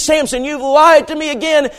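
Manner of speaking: high, loud complaint; Delilah. Acted. Delilah accusing Samson of lying, loud and aggrieved. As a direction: whine the accusation at full volume. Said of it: "She says".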